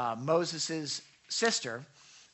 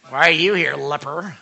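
sharp, louder indignant challenge; 9 dB louder than usual. Shown as a demonstration. Pastor voicing Jesus as indignant, scornful. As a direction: snap it at the leper with scorn. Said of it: "Indignant is like".

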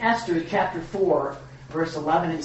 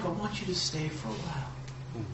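gentle inviting God; 9 dB quieter than usual. Acted. God voiced inviting listener.

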